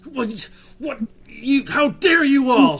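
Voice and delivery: high, furious shriek of protest; outraged yelling. Acted. Captive woman yelling at her captors, outraged. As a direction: scream it at them, indignant. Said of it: "like, yelling and screaming at the".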